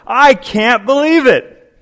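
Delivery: high, loud, slow fake fury; playacting mad in the car; mock angry outburst. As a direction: ham up being hot under the collar. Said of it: "a little mad, a little hot under the collar".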